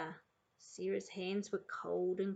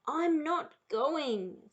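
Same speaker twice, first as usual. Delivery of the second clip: loud, drawn-out refusal, higher pitched; stubborn refusal; 6 semitones higher, 5 dB louder, much slower than usual. A narrator's reading. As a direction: dig in your heels, slowly. Sarah refusing to go to school.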